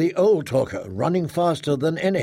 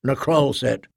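ordinary delivery, nothing marked

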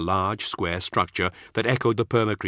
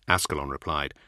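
ordinary delivery, nothing marked

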